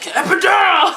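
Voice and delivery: high, loud cry for the epidural; shouted demand. Retold or imitated. Woman in labor shouting at staff, loud desperate yell. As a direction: yell it out in pain.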